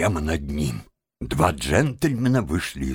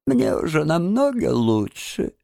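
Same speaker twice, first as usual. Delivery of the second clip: lightly voiced feeble lady, pitched high; weak voice; 7 semitones higher than usual. A narrator's reading. Toad poses as a fainted washerwoman to the gentlemen, weak voice. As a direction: answer faintly in a high, put-on lady's voice. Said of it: "слабым голосом".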